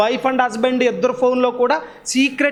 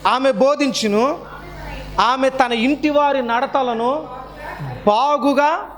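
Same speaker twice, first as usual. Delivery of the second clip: slow, measured verse reading with pauses; scripture reading; 3 semitones higher, much slower than usual. Acted. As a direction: read the verse deliberately, word by word. Pastor reads verse aloud. (నెమ్మదిగా వచనం చదవడం)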